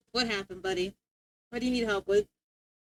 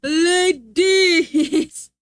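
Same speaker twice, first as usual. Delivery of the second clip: louder mock alert voice; imitating alert; 4 semitones higher, 6 dB louder than usual. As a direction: boom it out like the alert.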